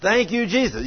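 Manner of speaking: loud, high praise; joyful thanks. Real, not acted. Healed woman thanks Jesus loudly, high and joyful. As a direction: thank God out loud with joy.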